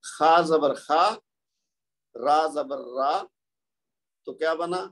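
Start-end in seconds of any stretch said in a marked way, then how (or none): 0.0-1.0 خا drawn out slowly from the throat; slow spelled syllables. Shown as a demonstration. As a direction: sound out khaa zabar khaa. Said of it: "تیسری line میں دیکھیے".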